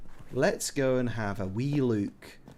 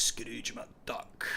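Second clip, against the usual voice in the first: Scrooge McDuck impression; 7 dB quieter than usual. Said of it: "I do my finest".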